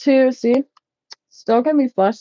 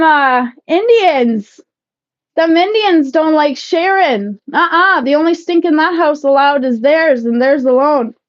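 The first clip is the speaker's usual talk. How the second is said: loud mock-serious lecture; loud joking rant; 6 semitones higher, 4 dB louder than usual. Real, not acted. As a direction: rant playfully at chat.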